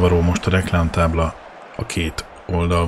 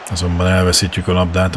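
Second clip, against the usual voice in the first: normal talking voice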